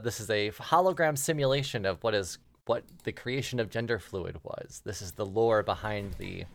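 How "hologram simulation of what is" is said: ordinary talk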